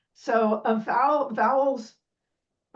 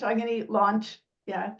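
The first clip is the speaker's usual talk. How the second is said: normal talking voice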